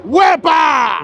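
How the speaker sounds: cheering "wepa" shout. Shown as a demonstration. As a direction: yell "wepa" like a party cheer. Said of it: "on the count of three, wepa".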